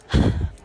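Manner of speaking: heavy audible sigh; real exasperated sigh. Real, not acted. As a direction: let out one loud breath. Worries piling up.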